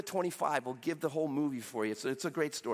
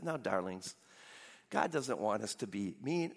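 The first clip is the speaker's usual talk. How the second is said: low, soft parental voice; mock-gentle parent; 8 semitones lower, 4 dB quieter than usual. Godly parent to fighting kids on a road trip, mock gentle. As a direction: play the sweetly patient, prayerful parent. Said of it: "He gently pulls off at the rest area".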